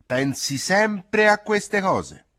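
loud, slow, high scolding shout. Acted. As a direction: yell at the girl to drop it. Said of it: "Masa le gridò".

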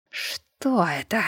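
slow, soft, menacing question; quiet threat in every word; ominous voice. A narrator's reading. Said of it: "Зловещим голосом".

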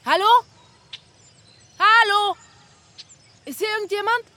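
loud, high call into the emptiness. Acted. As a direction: shout to find anyone around. Calling out on empty platform.

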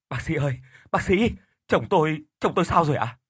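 anxious pleading. A narrator's reading. Worried wife begs the doctor about her husband, frantic. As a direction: clutch at the doctor, begging for news. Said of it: "gương mặt đầy lo lắng".

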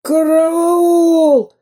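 very high, squealing help cry; panicked cry for help. A narrator's reading. Crushed spy Dyrka shrieks for help, panicked. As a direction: squeal under the crushing pirate. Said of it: "взмолился придушенный Дырка".

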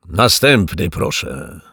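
loud, booming call. A narrator's reading. Voice from the cellar calling the next customer, booming. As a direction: boom it down the queue. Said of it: "tubalny głos".

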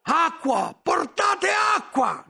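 high slow full shout; shouting for water. A narrator's reading. Novice yelling to the monks to bring water, full shout. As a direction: cry to the whole abbey for water.

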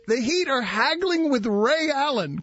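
ordinary delivery

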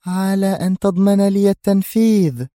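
high, alluring female voice; seductive. A narrator's reading. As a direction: set the condition temptingly, voice lifted high. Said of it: "ثم أردفت وهي ترمقه بنظرة مغرية".

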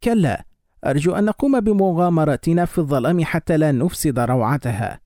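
usual speaking voice

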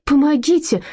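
high frantic call for help; отчаянный крик о помощи; desperate cry for help. A narrator's reading. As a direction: cry out in despair. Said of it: "Людмила отчаянно вскрикнула".

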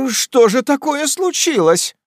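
high, loud, slow frightened cry; alarmed outcry. A narrator's reading. Ladies cry out to the host in alarm. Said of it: "вскрикнули леди".

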